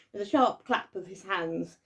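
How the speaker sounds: ordinary delivery, nothing marked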